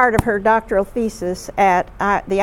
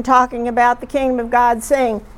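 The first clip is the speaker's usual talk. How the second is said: ordinary talk, nothing marked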